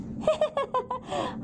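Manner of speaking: high little chuckle; a light, bouncy laugh from the small man; jolly laugh. Acted. Small builder laughs at the eager children, high chuckle. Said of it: "Hollis Bell laughed".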